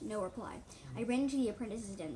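ordinary delivery

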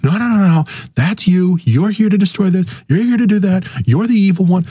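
shrill it out like a shouting pundit; mocking the yeller who drowns out reason; mock screaming. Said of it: "sitting there going".

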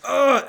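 loud, high grunt of pain. Retold or imitated. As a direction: let out a sharp hurt grunt. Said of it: "Snoke's like".

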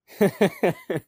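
loud high burst of laughter; real laugh. Real, not acted. Player laughs at break.

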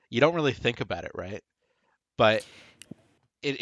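ordinary delivery

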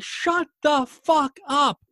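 high, loud, slow word-by-word outburst; exasperated mock yell. Fed-up listener talking back at a commercial, exasperated mock yell.

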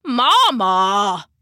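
put-on loud old-man call, falling at the end; complaining Papa. A narrator's reading. Papa calls to his wife while chewing jerky, complaining. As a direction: call out loudly as aggrieved Papa.

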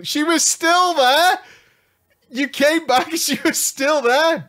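high, loud disbelief; shocked chat reaction; incredulous. Real, not acted.

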